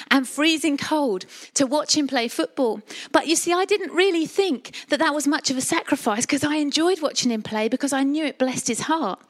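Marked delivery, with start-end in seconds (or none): none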